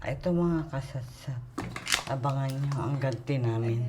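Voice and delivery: whispering. Real, not acted. Whispering near sleeping husband.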